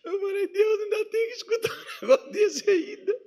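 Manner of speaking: very high, squeaky laughing complaint; lamento cômico rindo. Retold or imitated. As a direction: plead to God through laughter. Comic lament to God, laughing. (queixa aguda, segurando o riso)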